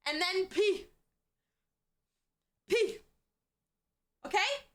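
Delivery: put-on high child voice with pauses; earnest small kid explaining, put on high; imitated little kid. Kid rambling to a patient adult, high child voice. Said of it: "the kids are like".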